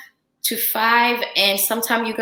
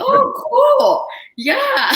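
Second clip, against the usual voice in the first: excited yes; 6 dB louder, much slower than usual. Retold or imitated. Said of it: "I was like".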